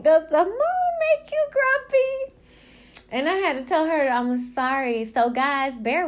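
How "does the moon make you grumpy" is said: put-on very high, slow child's voice; imitating the niece again. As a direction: copy the niece's innocent question, high and slow. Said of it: "she was like".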